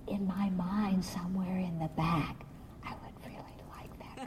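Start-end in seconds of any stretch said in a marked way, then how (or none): 2.8-4.2 confiding whisper. Real, not acted. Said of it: "admits secret wish".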